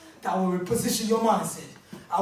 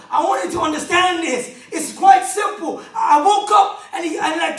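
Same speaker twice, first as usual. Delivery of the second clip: full-force preaching; 7 semitones higher, 6 dB louder than usual. Acted. Motivational speaker drilling the audience on their dreams, shouting sermon. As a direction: hammer each question home to the room.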